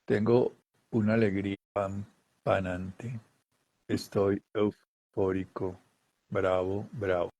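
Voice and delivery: low, soft, very slow flat "bravo"; deadpan mock euphoria. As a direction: claim euphoria with no energy at all. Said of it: "tú no dices". (euforia fingida, monótona y lenta)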